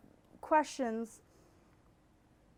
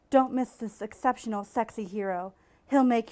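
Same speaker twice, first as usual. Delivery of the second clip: dramatic ad read; 5 dB louder than usual. Shown as a demonstration. Host finishing the romance ad aloud, louder trailer voice. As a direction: sell it like a cheesy paperback trailer. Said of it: "the text of the ad".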